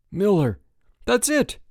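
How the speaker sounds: high, loud realization; exclaiming. A narrator's reading. Frank exclaiming, realizing where Miller is. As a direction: burst out with the idea. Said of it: "Frank exclaimed".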